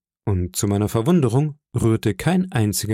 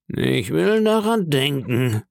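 drawn-out, oddly stressed reply; strange emphasis; 4 semitones higher, slower than usual. Acted. Silver answers the boy's plea, strangely emphasised. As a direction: stretch out DARAN with an ambiguous emphasis. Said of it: "mit einer so sonderbaren Betonung".

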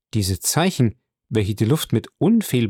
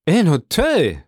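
higher, startled exclamation; sudden raised question, falling sharply; startled cry; 6 semitones higher, 4 dB louder, slower than usual. A narrator's reading. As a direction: cry it out in alarmed surprise. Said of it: "rief Villefort".

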